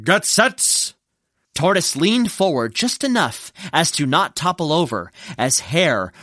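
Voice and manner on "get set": suspenseful starter's cue; brief ready-to-race call. Acted. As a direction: hold the runners on the line. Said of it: "and Fox said".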